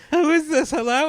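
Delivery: high, loud demand into the phone; imitating unaware older lady. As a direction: call down the line, loud and high. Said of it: "over the phone and she'd go".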